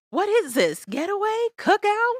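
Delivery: rising high-pitched guesses; playfully baffled. Real, not acted. Groping for the name of a family event, baffled.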